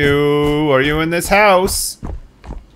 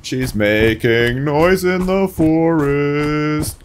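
slow stalking taunt; much slower than usual. Acted. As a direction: creep up on them with a lullaby threat.

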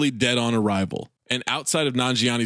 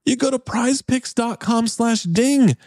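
usual speaking voice

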